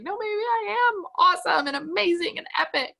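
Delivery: high-pitched imitation crying pep talk; mock tearful. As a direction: act out crying through your affirmations. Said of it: "then I start crying".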